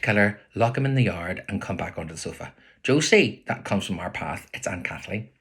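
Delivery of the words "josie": high, loud call from outside; calling a name. Acted. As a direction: hail the house from the path. Aunt calling from the path.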